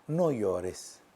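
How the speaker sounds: soft and low, gently comforting; quiet tender voice of the Lord. Acted. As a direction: hush it like consoling a grieving mother. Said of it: "qué palabras más, eh, más tiernas".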